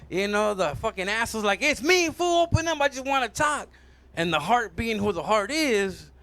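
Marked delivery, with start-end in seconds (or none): 1.6-3.7 high-pitched insistent wheedle; coaxing. Acted. Asshole asks the heart to open the door, coaxing. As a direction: talk your way in, voice pushed high.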